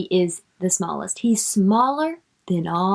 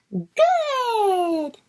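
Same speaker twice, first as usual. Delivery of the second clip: high, bright exclaimed Good; a little bug cheering Good; excited bug voice; 11 semitones higher than usual. Shown as a demonstration. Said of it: "That's how I think that little bug says it".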